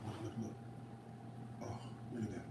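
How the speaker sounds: ordinary talk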